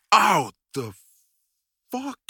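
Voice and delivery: sharp, loud, high yelp of pain; startled pained cry; sudden ow. Acted. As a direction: jolt out an ow as if struck. Partner hurts him mid-scene.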